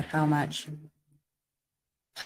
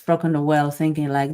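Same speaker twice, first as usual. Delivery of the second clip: ordinary delivery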